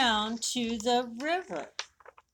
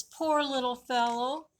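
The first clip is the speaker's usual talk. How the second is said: pitying; 4 semitones higher than usual. Acted. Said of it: "Bird says".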